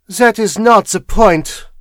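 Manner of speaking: slow, loud, high cold rebuff; cold foreign-accented dismissal; coldly. A narrator's reading. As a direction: measure out each word coldly. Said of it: "she said coldly".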